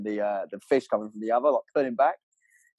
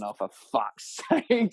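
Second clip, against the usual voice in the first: laughing exasperation; slower than usual. Retold or imitated. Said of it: "and I'm thinking".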